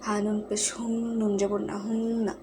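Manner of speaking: হুন্না with the n hummed through the nose; nasal gunnah. Shown as a demonstration. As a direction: hold the ন in হুন্না as a long nasal hum. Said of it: "এবং নুনটা গুণ হবে".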